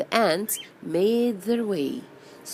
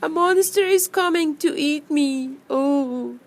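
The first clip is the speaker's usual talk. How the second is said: higher, louder story-voice alarm; frightened cry; 8 semitones higher, 5 dB louder than usual. Acted. Bee cries out about a coming monster, frightened. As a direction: act out a little bee's panic.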